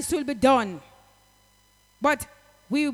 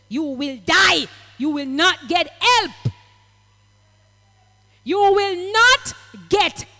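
preaching shout; 2 semitones higher, 7 dB louder, slower than usual. Acted. Preacher warning the unforgiving, loud preaching shout. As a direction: hurl the warning at them.

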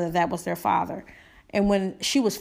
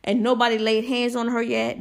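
normal talking voice

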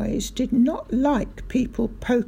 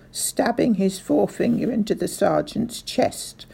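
usual speaking voice

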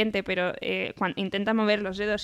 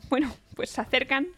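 nervous laughter while telling; laughing into sobs; 4 semitones higher, 6 dB louder than usual. Real, not acted. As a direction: laugh through it. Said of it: "no sé cómo te puedes reír".

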